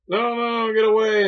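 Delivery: hammed-up high wail of refusal; mock protesting woman. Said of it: "she's like".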